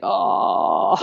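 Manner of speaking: long, very low groan; deep, drawn-out release of sound; stretching groan. Shown as a demonstration. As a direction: let the sound out as you stretch. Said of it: "and let sound out".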